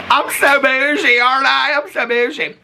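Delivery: high, slow, showy brag; mock bougie. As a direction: preen like a fancy lady. Showing off gold coffee cup.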